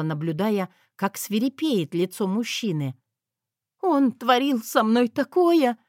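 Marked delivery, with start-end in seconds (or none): 3.8-5.8 high, slow, louder mock distress; feigned tearful wail. A narrator's reading. Wife acts the victim to threaten husband, feigned sobbing. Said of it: "несчастно всхлипнула".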